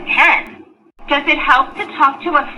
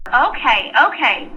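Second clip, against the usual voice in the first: usual speaking voice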